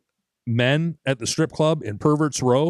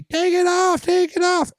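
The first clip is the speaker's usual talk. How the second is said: high catcalling women, imitated; hammed-up crowd of women shouting; mock women yelling; 3 dB louder, slower than usual. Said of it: "women starting yelling at me".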